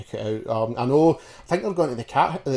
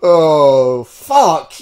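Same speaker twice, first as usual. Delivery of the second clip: exasperated groan; 2 semitones higher, 11 dB louder than usual. Real, not acted. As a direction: groan it out loud in dismay. Dismay at five days of wrestling.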